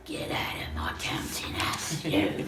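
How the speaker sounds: hushed, hammed-up whisper; whispered Scrooge impression. Scrooge ordering someone out of his counting house, mock whisper. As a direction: whisper it as a miserly old boss. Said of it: "And then he's like".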